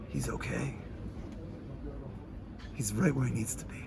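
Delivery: soft whispered reassurance; reassuring whisper. Retold or imitated. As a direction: whisper it close to his ear. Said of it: "so reassuringly".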